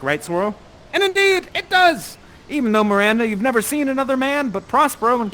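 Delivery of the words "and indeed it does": much higher, louder declaration; triumphant. Acted. Narrator triumphantly confirming the love spell works. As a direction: crow it like a victory.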